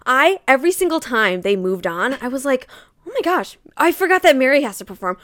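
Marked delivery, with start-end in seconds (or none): 3.1-5.2 high, rushed, building excitement; retold amazement. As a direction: retell it as mounting disbelief, faster and louder. Retold escalating amazement at each act.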